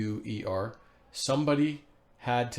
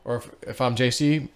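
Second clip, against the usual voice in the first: ordinary talk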